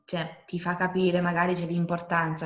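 ordinary talk, nothing marked

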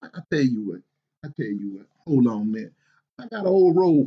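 ordinary talk

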